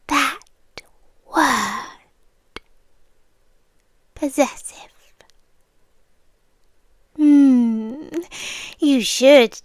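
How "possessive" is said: Possessive repeated in a teasing whisper. Acted. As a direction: whisper Possessive back to him like a secret. Repeats the word he trembled at.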